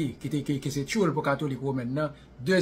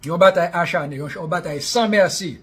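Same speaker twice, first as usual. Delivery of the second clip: emphatic louder repeat; 5 semitones higher, 9 dB louder than usual. Real, not acted. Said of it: "désolé, repeats louder".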